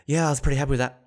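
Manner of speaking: politely understated athlete's reply; matter-of-fact interviewee. Retold or imitated. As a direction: answer briefly, to the point. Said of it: "so polite and just matter of fact".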